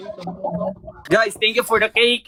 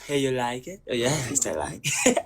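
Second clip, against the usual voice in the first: much lower, soft mock accent; Elijah's accent; 9 semitones lower, 8 dB quieter than usual. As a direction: drop the voice low and copy his accent. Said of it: "Elijah, accent be like". (malalim na gayang punto ni Elijah)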